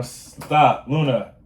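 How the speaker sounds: slow, loud stop, Luna; real sharp call. Real, not acted. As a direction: cut in and stop the pet firmly. Speaker suddenly scolds her pet.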